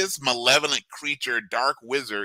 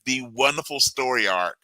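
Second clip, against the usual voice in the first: normal talking voice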